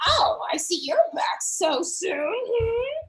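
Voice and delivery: put-on slow, raspy old crone voice; old goblin grandma. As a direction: drawl it out like a wary old shaman. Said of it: "She's like".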